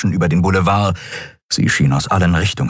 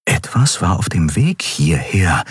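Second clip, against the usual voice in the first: ordinary delivery, nothing marked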